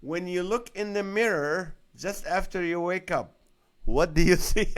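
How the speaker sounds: can barely get the question out for laughing; amused read that bursts loud on the last words. Real, not acted. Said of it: "The question is funny".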